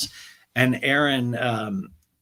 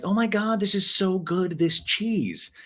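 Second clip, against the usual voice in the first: mock delight; 9 semitones higher, slower than usual. Erin savoring cheese.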